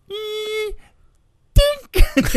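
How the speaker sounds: high, squeaky mock clink of toy cars; imitated tiny car crash. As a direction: squeak it like two toys tapping together. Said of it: "kind of like, like".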